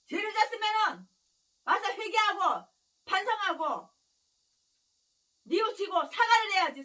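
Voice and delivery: loud, slowed, pressing each verb; fervent preaching shout. Acted. Preacher demanding repentance from the guilty, loud and fervent. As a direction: hammer the call to repent.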